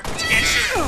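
loud yelled rule; shouting. Real, not acted. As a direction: yell it over the game noise. Laying down game rules, shouting.

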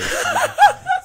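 loud, high-pitched laugh; real high cackling laugh; burst of laughter. Real, not acted. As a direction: crack up at your own story. Laughs at his own crazy story.

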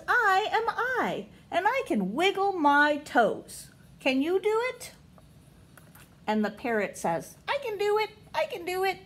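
storybook voice; reading to children. Acted. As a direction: read to the little ones, lifting each question. Reading picture book to kids.

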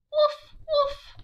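put-on bouncy high puppy barks for kids. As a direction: bark like a little puppy. Dog barks in picture book.

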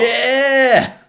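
a high, loud burst of excitement; excited yeah. Real, not acted. Excited yell to friend at the screen. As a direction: shout it out, thrilled. Excited reaction while watching the game.

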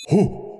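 short worried dog "whoo"; imitated dog gasp. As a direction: mimic a startled dog's little hoot. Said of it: "as soon as the door closed, she goes".

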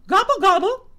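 high, loud gobbling call; loud gobble gobble; turkey gobble. A narrator's reading. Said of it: "called the turkey".

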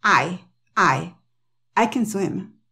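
I alone first, then accented over a short CAN; stress on I. Shown as a demonstration. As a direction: lean on I, reduce CAN to a schwa. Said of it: "el acento va en el sujeto".

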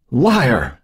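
high, sharp screech. Acted. Joan screeching at her sister, furious. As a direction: spit the accusation at her. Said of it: "Joan screeched".

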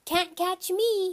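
high, slow taunting holler; high-pitched sing-song child's taunt. Acted. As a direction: holler it like a teasing little kid. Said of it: "he hollered".

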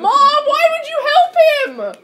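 very high, loud, drawn-out protest; outraged yell. Real, not acted. Player protesting to mom for helping a rival, outraged yell. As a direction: wail it slowly at full volume.

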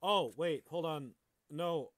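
ordinary talk

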